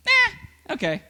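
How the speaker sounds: casual shrug. Shown as a demonstration. Demo of forgiving shrug.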